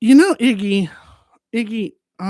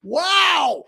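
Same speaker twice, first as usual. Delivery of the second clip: much higher, cartoonish; squeaky wow; 10 semitones higher than usual. High squeaky reaction.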